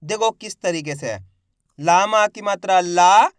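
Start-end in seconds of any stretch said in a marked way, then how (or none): 1.8-3.3 ला stretched long, slow and loud; drawn-out syllable reading. Shown as a demonstration. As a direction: read ला drawing the vowel out. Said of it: "तो खींच के पढ़ेंगे". (ला को खींच के पढ़ो)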